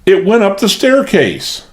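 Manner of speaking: high panicked cry; wild frightened cry. A narrator's reading. Maid telling of the thing on the stairs, frightened high cry. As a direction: blurt it out in terror. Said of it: "cried Lizzie wildly".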